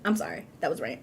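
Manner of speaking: soft sheepish wrap-up; trailing off. Real, not acted. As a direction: drop it quietly, embarrassed. Winding down after rant.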